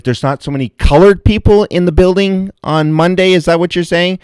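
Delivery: high, loud indignant challenge. Retold or imitated. To the waitress about Monday crowds, high loud indignation. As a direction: confront her, incredulous and angry.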